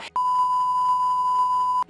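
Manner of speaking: squealing burst of laughter; high shrieking laugh. Real, not acted. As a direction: let a high giggle shriek out. Laughing at herself.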